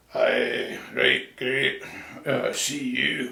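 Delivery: gruff Glaswegian grunts, words barely opened; grunting Glasgow accent. Retold or imitated. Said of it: "Glasgow it's mostly grunts".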